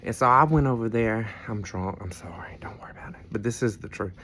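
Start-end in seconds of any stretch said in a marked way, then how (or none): 1.5-2.6 whispered confession; a hushed admission, just for viewers; whispered aside. Real, not acted. 2.6-3.2 breathy hush, waving it off; a soft whispered brush-off; whispered aside. Real, not acted. Hushed aside to viewers.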